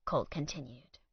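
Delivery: plain reading voice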